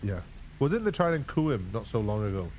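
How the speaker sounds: ordinary delivery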